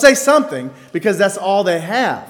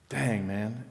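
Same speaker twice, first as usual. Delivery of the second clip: hushed dismay; 8 semitones lower, 12 dB quieter than usual. Retold or imitated. Reacting to shocking news.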